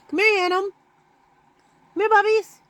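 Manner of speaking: high coaxing call to the cats; calling pets. Real, not acted. Owner calling her cats to come, high coaxing voice.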